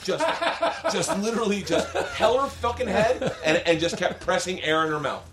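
high, laughing through the words. Real, not acted. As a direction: tell it cracking up. Laughing while telling.

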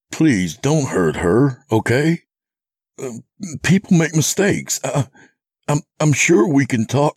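high, halting, faltering plea; beg, stumbling for words; desperate pleading. Acted. Narrator begs captor to spare Sadie, pleading.

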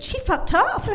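put-on punchline in an amateur Kentucky accent; bad Kentucky accent. Cabin owner says what the lion did, high Kentucky accent. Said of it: "my very bad Kentucky accent".

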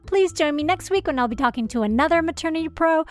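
bright host outro read; podcast outro. Acted.

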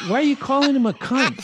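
high, incredulous retort; his own past self, amazed and high; shocked disbelief. Retold or imitated. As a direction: act stunned by the insult. Shocked reply to stunt guys.

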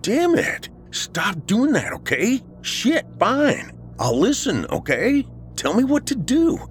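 very high, jumpy exasperation; rattled, exasperated. Acted. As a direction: give in while still startled and annoyed. Dave jumps at friend's outburst.

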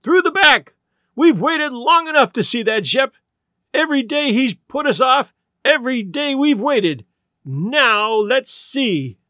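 high, indignant rabble-rousing; rallying cry. A narrator's reading. Old lady leading a revolt, crying out. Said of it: "cried Missus Bellows, feeling like Madame Lafarge".

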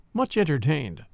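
usual speaking voice

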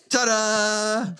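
high mock flourish; echoed showman ta-da. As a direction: repeat the magician's ta-da. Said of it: "repeats punchline".

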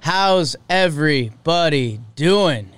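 slow, drawn-out welcome; easy, unhurried host greeting. Real, not acted. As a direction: stretch each word of the hello.

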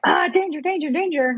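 high-pitched alarm from the primal brain; hammed-up panicky warning; mock panic. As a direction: yelp it like a comic inner alarm. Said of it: "primal brain of, like".

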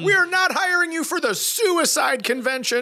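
mock outraged organizer. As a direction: scold the comic as the host.

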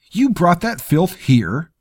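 loud, high, slow snarl of disgust. A narrator's reading. Lord rebukes the sergeant for the sack, snarling. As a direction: spit the words in revulsion. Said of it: "Terrell snarled".

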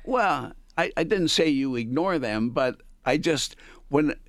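ordinary talk, nothing marked

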